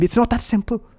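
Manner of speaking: high-pitched British retort; fake British accent. Inner critic refusing to change beliefs, fake British accent. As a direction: object in a put-on British accent. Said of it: "your fake British accent".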